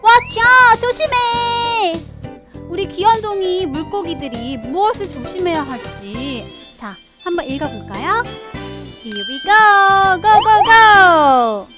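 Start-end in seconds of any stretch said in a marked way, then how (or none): 0.0-2.0 big storytime shout of caution; warning call. Acted. Calling out the book's warning title. 9.0-11.7 loud, slow, drawn-out cheer; big stretched-out kickoff call; rallying cheer. Acted. Rallying children to start reading.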